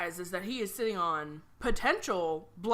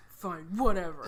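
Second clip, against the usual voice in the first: sulky; 2 semitones lower than usual. Retold or imitated. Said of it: "he was like".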